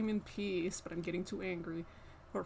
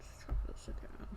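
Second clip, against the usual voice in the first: weary whisper; 7 dB quieter than usual. Real, not acted. Frustrated streamer whispering to herself after the cat interrupts. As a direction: breathe it out, worn down.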